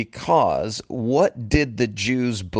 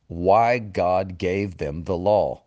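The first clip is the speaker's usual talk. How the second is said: usual speaking voice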